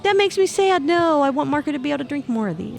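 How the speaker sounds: high, whiny pretend voice; whiny mock voice. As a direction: whine it like a sad friend. Voicing Marco sadly.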